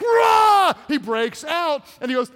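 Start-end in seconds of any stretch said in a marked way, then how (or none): 0.0-0.7 loud, high "rah" roar; roar of effort. Acted. Preacher as Samson snapping the straps, roaring. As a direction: burst free with a big yell. Imitating Samson breaking free.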